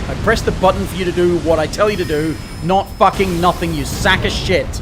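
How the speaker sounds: loud furious yelling; angry shouting. Real, not acted. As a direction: berate the character at full volume. Yelling at his character.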